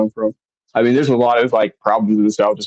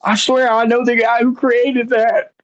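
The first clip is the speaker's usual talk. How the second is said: put-on future old-timer; 14 semitones higher than usual. Boasting to people in old age about a famous friend, high old-timer voice. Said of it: "telling people whenever I get older, like".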